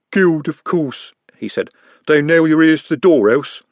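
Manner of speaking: matter-of-fact menace; a lightly voiced street thief; Jumbo's voice. Jumbo speaks, distinct voice.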